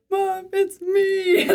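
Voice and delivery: high, slow mock boy with a breaking voice; brother on the phone. Sister imitating her brother answering mom's call, high and slow. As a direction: stretch it out high like a teen boy whose voice is breaking. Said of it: "he would be like".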